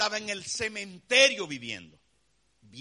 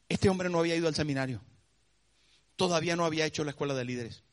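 normal talking voice

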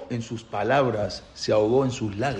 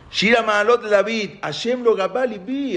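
high, ringing recitation of scripture; psalm declamation; 9 semitones higher than usual. Acted. Reciting David's newly composed psalm.

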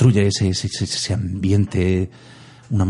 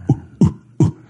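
breathy monkey hoots; ape grunts. Retold or imitated. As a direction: hoot like an orangutan. Imitating the orangutan.

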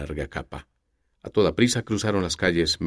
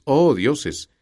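high, loud cry of surprise; Oh dioses burst out, higher and louder; startled exclamation; 8 semitones higher, 6 dB louder than usual. Acted. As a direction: gasp it out as the name sinks in. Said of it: "exclamó Nidia".

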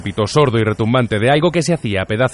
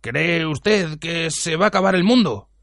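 slow, high, tentative voice; awed, fearful question; 5 semitones higher, slower than usual. A narrator's reading. Bartender asking Ford about the world's end, awed and slow. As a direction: ask slowly, hardly daring to.

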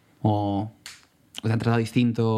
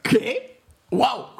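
high-pitched, incredulous outburst; astonished reaction; 11 semitones higher than usual. Real, not acted. Co-host caught out by the Vinicius reference, astonished. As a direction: react as if completely thrown by the remark.

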